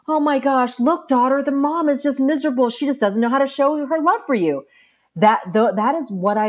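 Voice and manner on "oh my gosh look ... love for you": higher, urgent plea to the screen; higher voice, begging the daughter to see it; pleading viewer. Retold or imitated. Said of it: "you're watching this going".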